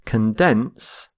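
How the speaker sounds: "condense" with the weight on DENSE; short unstressed first syllable in "condense", strong DENSE; stress on second syllable. Shown as a demonstration. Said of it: "this shift in stress".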